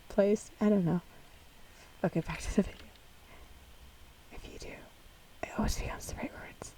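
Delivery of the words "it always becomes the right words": whispered aside. Real, not acted. Vlogger whispers a side comment to viewers while watching, hushed. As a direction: breathe it to the viewers.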